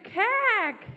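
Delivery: high, loud cack-cack; magpie call. A narrator's reading. Magpie squawks back, high and loud. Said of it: "said the magpie".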